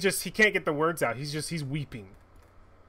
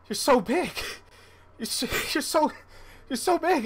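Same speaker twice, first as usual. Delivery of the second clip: high, choked-up voice of a weeping father; father reunited with his grown daughter, high and tearful; overwhelmed old father; 13 semitones higher, 5 dB louder than usual. Acted. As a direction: gasp it out high, overcome with joy.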